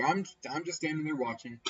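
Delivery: ordinary delivery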